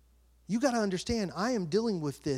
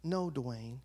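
very low, soft correction; understated divine rebuke; voice of God; 10 semitones lower, 8 dB quieter than usual. Retold or imitated. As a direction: calmly shut it down, deep and quiet. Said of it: "And he's like".

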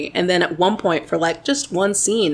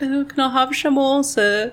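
higher, hammed-up British plea for more; a put-on frail British kid asking for more; mock British sick kid; 8 semitones higher than usual. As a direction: play up a pathetic little British urchin begging. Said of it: "he gets to be British".

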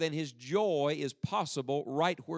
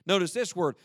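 usual speaking voice

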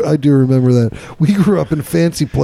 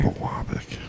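whispered; 14 dB quieter than usual. Real, not acted. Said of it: "repeats strange name".

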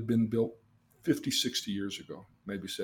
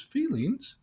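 high rising baffled "Feelings?"; a grandpa echoing "Feelings?" with a high, startled lift; puzzled grandpa; 13 semitones higher than usual. Retold or imitated.

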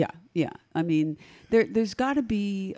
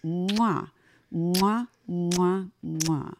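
kiss sounds; much slower than usual. Acted. Blowing kisses to the microphone.

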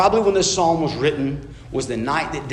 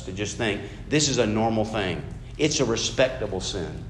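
usual speaking voice

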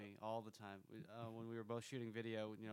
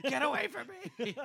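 high, loud, drawn-out yell through laughter; retold yell; 14 semitones higher, 15 dB louder, slower than usual. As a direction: re-enact yelling him off while laughing. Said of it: "I remember yelling at him".

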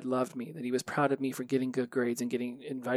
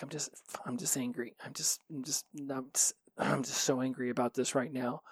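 whisper; 6 dB quieter than usual. Retold or imitated. Telling girlfriend he's angry, hushed.

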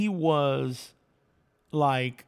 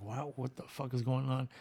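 hushed amazement; 2 semitones lower, 9 dB quieter than usual. Retold or imitated.

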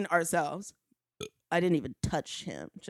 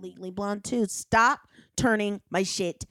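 usual speaking voice

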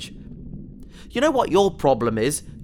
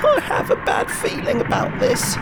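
breathy fearful whisper, high and tight; scared whisper. Acted. Alone in the tank, dreading Bone Crusher, fearful whisper. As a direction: whisper it to yourself, dreading what comes.

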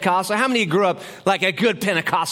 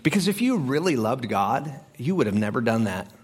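lower, acted accusing voice; accusing doubt; 6 semitones lower than usual. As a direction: question them lightly, sinking lower to the end. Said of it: "here's what it sounds like".

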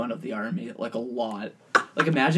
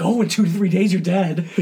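higher, louder mock announcement; mock warning; 5 semitones higher, 6 dB louder than usual. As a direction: deliver a hammed-up death sentence. Acted quote about a spider bite.

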